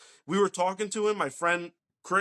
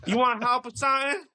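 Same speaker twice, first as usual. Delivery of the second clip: imitated street local; 9 semitones higher, 4 dB louder than usual. Retelling who approached them in Ohio, high caricature voice.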